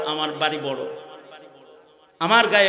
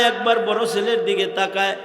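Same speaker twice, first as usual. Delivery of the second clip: usual speaking voice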